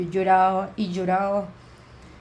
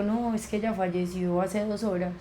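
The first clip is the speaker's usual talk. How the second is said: somber news; 6 dB quieter than usual. Retold or imitated. Breaking death news by phone.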